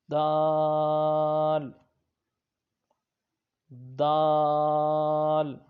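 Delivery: دال stretched very long and loud; drawn-out letter. Shown as a demonstration. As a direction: hold دال long, like reciting. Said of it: "اس کو تھوڑا سا لمبا کر کے پڑھیں گے". (دال کو لمبا کھینچ کر پڑھیں)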